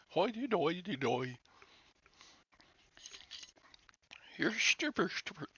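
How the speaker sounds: high, singsong mock-Swedish gibberish; Swedish Chef. Trying for Irish but landing on singsong mock-Swedish. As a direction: babble in a silly fake Swedish accent. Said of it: "that's Swedish Chef".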